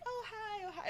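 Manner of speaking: high, hushed little hello; meek greeting. Retold or imitated. Imitating shy girls greeting her, high and hushed. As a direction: mimic a timid fan saying hi softly.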